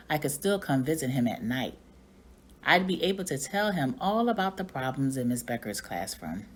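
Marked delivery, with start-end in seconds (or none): none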